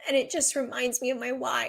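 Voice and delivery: usual speaking voice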